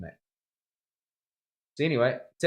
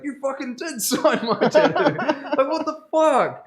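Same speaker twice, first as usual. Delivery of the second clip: high, slow, laughing disbelief; imitating the mate's stunned laughing outburst; laughing shock; 8 dB louder, slower than usual. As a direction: squeal it through laughter, high and loud. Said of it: "he's like".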